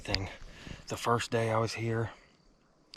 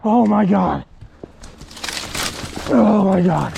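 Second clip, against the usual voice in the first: screaming with disbelief, much higher; ecstatic shouting; 12 semitones higher, 13 dB louder than usual. Real, not acted. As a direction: yell it out, overwhelmed with joy. Hunter just shot a turkey.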